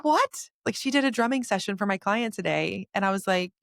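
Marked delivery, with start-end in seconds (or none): 0.0-0.5 high, louder incredulous cry; stunned, high-pitched exclamation; retold astonishment. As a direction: pitch it way up in disbelief. Retelling her amazed reaction.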